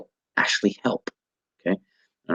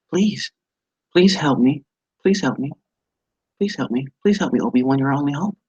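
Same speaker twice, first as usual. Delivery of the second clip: higher, mildly pleading repeats; flat pleading; 7 semitones higher than usual. Shown as a demonstration. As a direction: ask for help without much urgency. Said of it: "So you say".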